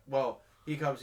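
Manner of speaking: ordinary talk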